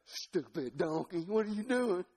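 lightly voiced griping yokel; slow, mock-annoyed scolding; exasperated Balaam. Preacher as Balaam scolding stalled donkey, mock exasperation.

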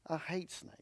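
whispered, half to himself; a hushed aside about hating snakes; whispered confession. Real, not acted. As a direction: confide it in a whisper. Confides dislike of snakes.

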